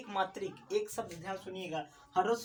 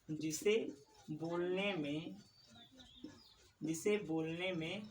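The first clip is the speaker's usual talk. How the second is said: slow dictation; 3 semitones lower, 6 dB quieter, slower than usual. Shown as a demonstration. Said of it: "teacher repeats for dictation".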